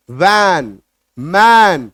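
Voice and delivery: वैन and मैन said loud with a long, pushed ae; forceful ae words. Shown as a demonstration. Teacher demonstrating forceful vowel in वैन and मैन. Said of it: "उच्चारण थोड़ा सा जोर लगा के करेंगे".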